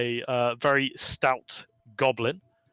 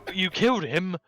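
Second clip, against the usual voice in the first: mildly excited goblin clerk; eager at first, then doubtful; lightly voiced excited goblin. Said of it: "very excitedly and go".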